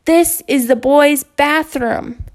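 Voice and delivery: high, loud, slow protest; a boy telling his teacher she does not belong there; indignant boy. Acted. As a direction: insist on it slowly, offended. Boy pushing teacher away.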